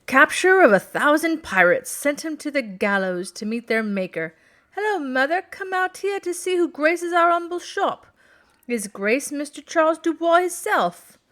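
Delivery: shopkeeper. A narrator's reading. Shopkeeper calls his mother to see the duke, high and fawning.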